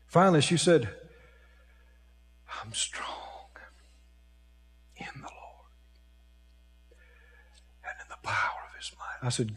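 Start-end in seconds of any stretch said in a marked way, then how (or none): 2.6-9.3 imitating a frail woman, barely audible; weak whisper. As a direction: whisper it feebly with long gaps, as if too weak to speak. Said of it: "So weak".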